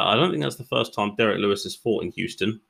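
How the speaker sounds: normal talking voice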